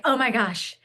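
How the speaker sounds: high, loud giddy squeal of delight; thrilled, breathless self-quote about a free class; retold excitement. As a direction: gush like you just got discovered. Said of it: "I was so excited".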